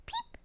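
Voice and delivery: tiny, very high soft peep; chick's peep. A narrator's reading. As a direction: cheep like a little chick. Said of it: "her chick said".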